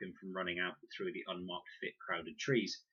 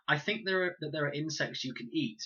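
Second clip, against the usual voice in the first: blurted out; 8 semitones higher, 4 dB louder than usual. A narrator's reading. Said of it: "he blurted out".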